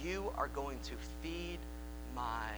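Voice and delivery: hushed, tender reassurance; tender Jesus. Acted. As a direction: gently reassure a broken friend. Jesus voiced tenderly to Peter.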